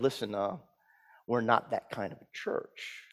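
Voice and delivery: low, hushed correction; discreet reproof. Retold or imitated. Usher to boy in a formal church, discreet reproof. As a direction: quietly put him in his place.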